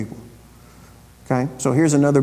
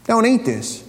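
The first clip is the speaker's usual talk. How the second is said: mock warning label; 7 semitones higher than usual. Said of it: "What does it say?".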